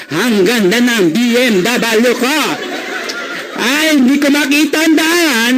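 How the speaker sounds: put-on slow, comic elderly voice; hammed-up old person at the wheel; ninety-year-old driver. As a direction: act a very old BMW owner, rising at the end. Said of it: "ninety years old".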